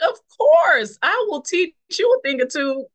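put-on boasting to the girl who offered Bible study; cocky playful brag. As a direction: tease her like you know it all. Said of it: "I'm like".

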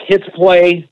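ordinary talk, nothing marked

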